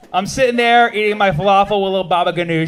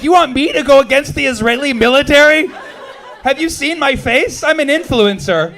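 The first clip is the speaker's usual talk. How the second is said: mock incredulous; 8 semitones higher, 4 dB louder than usual. Comic answering fans who want him harsher, incredulous. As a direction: act stunned at the very idea. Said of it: "I'm like".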